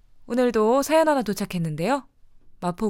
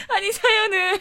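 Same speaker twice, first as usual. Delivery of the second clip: high burst of laughter; laughing; 11 semitones higher, 7 dB louder than usual. Real, not acted. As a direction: laugh through the words.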